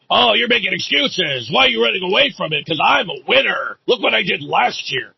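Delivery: mock braggart. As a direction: sneer out his boastful accusations. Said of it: "This is what he talks like".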